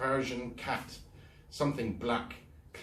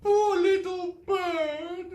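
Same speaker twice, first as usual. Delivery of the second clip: very high, slow rising wail; 7 dB louder, much slower than usual. Acted. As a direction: mourn the bird again. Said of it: "wailed Miss Gum for a second time".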